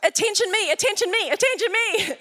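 high, whiny mock dog begging for attention; needy dog voice. Pastor voicing the dog begging for attention, high whine. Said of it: "it's like".

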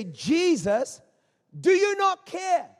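loud, accusing appeal to Jesus; desperate cry. Acted. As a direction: cry out as a frightened disciple demanding help. Disciples' panicked challenge to Jesus.

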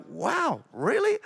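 high squeak of disbelief; mock shock. Retold shocked reaction.

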